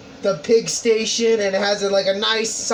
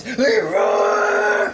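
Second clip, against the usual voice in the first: high, strained charge yell; battle cry; 11 semitones higher than usual. Acted. Marine charges into the attack.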